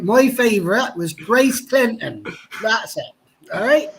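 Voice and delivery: high, slow West Country farmer drawl; old farmer. Pretend. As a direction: play a rustic old farmer. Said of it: "went down to my farmer voice".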